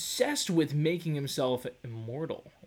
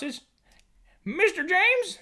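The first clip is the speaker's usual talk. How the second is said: mock surprise; 5 dB louder than usual.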